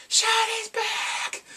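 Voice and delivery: high, drawn-out fangirl shriek; loud mock crowd cheer, stretched out; excited fan scream. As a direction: shout it like an ecstatic concert fan. Acting out excited concert fans.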